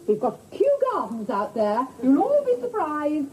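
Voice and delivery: normal talking voice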